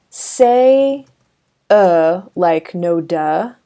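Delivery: loud, stretched "uh" as in "no duh"; modelled "uh". Shown as a demonstration. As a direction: belt out the "uh" sound. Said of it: "I want you to say".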